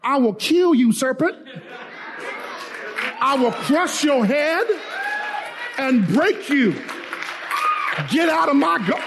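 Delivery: high-pitched, forceful warning to an intruder; preacher acting out a furious protector; angry threat. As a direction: warn off the serpent, rising in intensity. Acted husband confronting the serpent.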